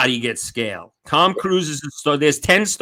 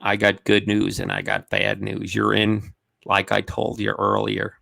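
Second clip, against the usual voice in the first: manager on the phone; 4 semitones lower, 5 dB quieter than usual. Retold or imitated. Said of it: "Oh, yeah".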